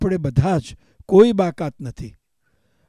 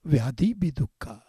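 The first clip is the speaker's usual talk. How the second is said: soft repeat; 4 semitones lower, 6 dB quieter than usual. Shown as a demonstration. Softer repeat.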